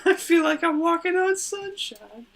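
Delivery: high, slow dreamy sing-song voice; silly high voice. Pretend. Co-host answering with a giddy high voice.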